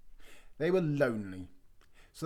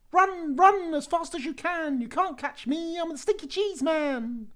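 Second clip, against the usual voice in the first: much higher, louder sing-song taunt; cheeky cheese man; 6 dB louder than usual. Acted. The cheese man taunts the old couple, high sing-song. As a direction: tease them from a safe distance, high and bouncy.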